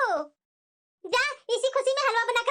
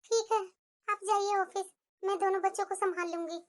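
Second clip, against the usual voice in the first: much softer, drained resignation; weak and quiet; 5 semitones lower, 6 dB quieter than usual. Real, not acted. Exhausted new mother quietly agreeing to look after both children. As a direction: agree with no strength left. Sick wife agrees to manage.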